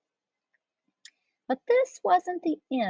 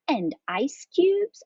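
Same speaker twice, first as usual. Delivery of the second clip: high, slow rising question; kitten boy asking; 8 semitones higher, 3 dB louder, slower than usual. A narrator's reading. Skippy asks Mama for a treat, childlike asking. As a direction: ask hopefully like a little boy. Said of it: "asked Skippy".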